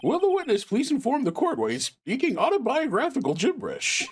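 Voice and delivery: high, incredulous judge voice; an old judge scolding the witness. Acted.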